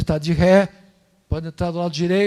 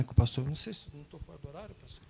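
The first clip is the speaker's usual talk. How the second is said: normal talking voice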